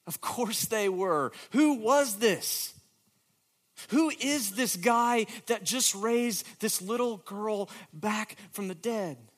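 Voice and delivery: preacher marvelling who could raise the dead; rising amazement. Real, not acted. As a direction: swell with wonder.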